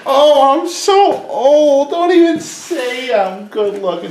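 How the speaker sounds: put-on high-pitched, drawn-out old lady protest; mock old woman. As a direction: imitate an old woman slowly wailing her reply. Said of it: "And she goes".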